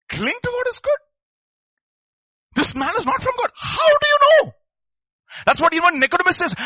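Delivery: impassioned full-force sermon climax; preaching at full force. Acted. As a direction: thunder it from the pulpit.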